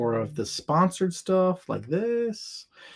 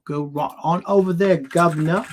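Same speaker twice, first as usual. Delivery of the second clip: higher, louder mock-British accent; playful British accent, pitched up and loud; 5 semitones higher, 6 dB louder than usual. Shown as a demonstration. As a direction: put on a hammy British "guv'nor" accent. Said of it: "It's my British accent".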